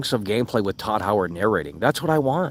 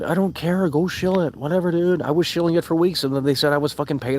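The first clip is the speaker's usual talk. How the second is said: raised, dismissive whatever-dude rant; exasperated; 7 semitones higher than usual. Real, not acted. Streamer brushing off chat's Starfield shilling accusations, exasperated. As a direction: brush off chat, higher and sharper.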